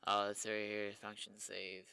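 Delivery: normal talking voice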